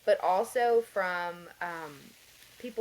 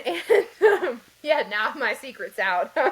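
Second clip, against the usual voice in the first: laughing; 6 semitones higher, 11 dB louder, slower than usual. Real, not acted. Laughs at confessing she screens calls.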